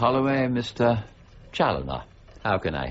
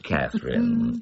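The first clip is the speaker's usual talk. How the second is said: ordinary talk, nothing marked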